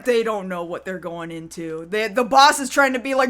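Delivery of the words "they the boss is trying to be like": ordinary talk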